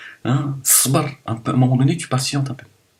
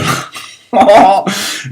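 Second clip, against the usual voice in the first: grand rire aigu et fort; éclate de rire, moqueur; éclat de rire; 11 semitones higher, 9 dB louder than usual. Real, not acted. Rire après blablabla moqueur. (gros éclat de rire aigu)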